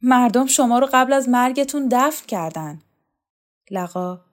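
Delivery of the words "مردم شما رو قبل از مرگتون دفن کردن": loud high shriek. A narrator's reading. The woman screaming at the guards, loud and high. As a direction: scream it at them. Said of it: "زن جیغ کشید".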